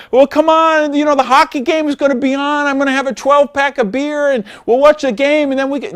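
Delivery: high, pleading mock beggar, rushed; begging distributor. Coach mocks a distributor begging a prospect, high and rushed. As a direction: ham up a new distributor begging a friend. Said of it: "no, don't beg people".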